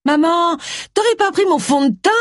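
called high from the doorway; calling out. A narrator's reading. Tracy calls down to her mother from her doorway, high call. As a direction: call out across the house to mother.

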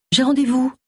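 high-pitched quick excuse; lightly evasive reply. A narrator's reading. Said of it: "éluda-t-elle".

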